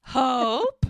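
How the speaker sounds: loud mock call across a restaurant; acted hail. Muriel spots Doc and Hope at dinner, loud exclamatory hail. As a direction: call out their names as a showy question. Woman hailing acquaintances in retold scene.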